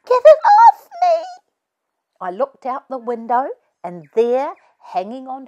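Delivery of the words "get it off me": put-on high loud panicked child's shriek; toddler scream. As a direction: scream like a little girl in a panic. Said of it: "I heard her scream".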